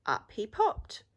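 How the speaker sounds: usual speaking voice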